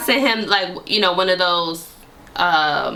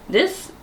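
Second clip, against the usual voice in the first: this pitched sharply up on a rising swoop; this jumps way up, questioning; stress on this; 10 semitones higher than usual. Retold or imitated. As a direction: lift the word this high and let it rise. Stressed word in retold line.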